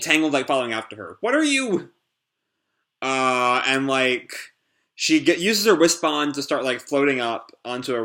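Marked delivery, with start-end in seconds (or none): 1.2-1.9 much higher, startled; shocked question. Acted. Tangle asks the strange Sonic what he is, high startled question. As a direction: ask in disbelief.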